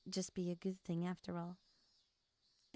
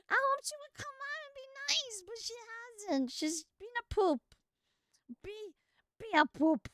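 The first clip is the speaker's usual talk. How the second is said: higher whiny pouting complaint; mock pout; 13 semitones higher, 4 dB louder than usual. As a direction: sulk about her playfully.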